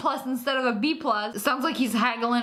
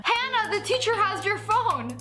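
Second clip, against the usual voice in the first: pitched up high like a panicky schoolgirl; high friend voice; 9 semitones higher than usual. Retold or imitated. Friend texting a classmate in class, high squeaky voice. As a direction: squeak out the warning. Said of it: "her friend texted her phone".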